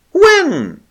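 very high dazed squeak; stammered. Acted. O'Keefe to Lakla, stammering high. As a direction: stammer it out foolishly. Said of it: "he stammered fatuously".

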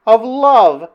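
high, loud startled question; changed manner, startled. A narrator's reading. Princess answers Flynn's talk of love, startled. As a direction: echo it back, taken aback. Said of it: "her whole manner changing".

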